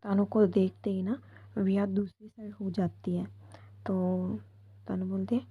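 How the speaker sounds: ordinary delivery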